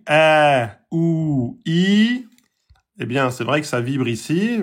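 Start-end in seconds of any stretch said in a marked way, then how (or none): 0.1-2.3 long vowels a, ou, i drawn out; long vowel demo. Shown as a demonstration. Teacher holding out a, ou, i for students. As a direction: sustain each long vowel. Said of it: "quand vous dites les trois voyelles longues".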